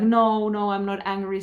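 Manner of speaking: loud, hurried "no, no" brushing anger off; mock denial. The head denying anger to the listener, mock protest. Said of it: "says the head".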